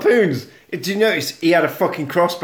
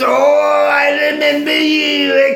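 hammed-up old acquaintance; 8 semitones higher, 3 dB louder than usual. Jack greets the colonel after forty years, hammed-up wail. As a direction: wail the greeting theatrically, climbing very high.

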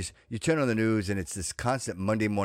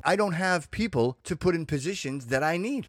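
normal talking voice

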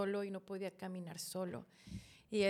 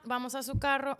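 ordinary delivery

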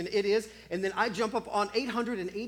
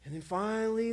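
ordinary talk, nothing marked

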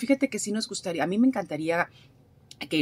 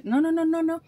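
high, loud repeated no; emphatic no; 10 semitones higher, 4 dB louder than usual. Real, not acted. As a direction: insist no, no, no. Emphatic denial.

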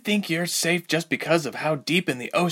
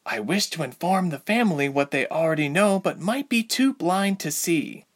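icy; 8 semitones higher than usual. A narrator's reading. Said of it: "Ilse said icily".

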